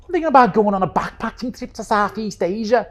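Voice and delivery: full-on Scouse, high and loud; hammed-up, high-pitched Scouse. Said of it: "go full, full, like, stereotypical Liverpool".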